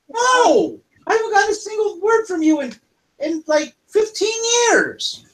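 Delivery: high, crying-out complaint; plead, close to tears; high distressed kobold voice; tearful protest. Acted. Reunion, long lost friend upset.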